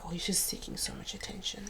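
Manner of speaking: hushed, whispery mock of disapproving relatives; imitated gossip. Family thinking she was a crybaby, hushed disapproval. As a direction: drop it to a near-whisper, like gossip.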